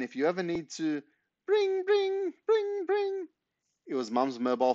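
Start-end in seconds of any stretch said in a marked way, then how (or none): 1.5-3.3 imitated phone ring.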